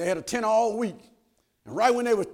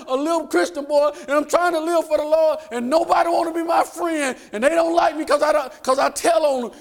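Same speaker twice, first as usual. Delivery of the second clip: put-on higher, louder complaining boy voice; upset grandson; 7 semitones higher, 4 dB louder than usual. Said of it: "He said".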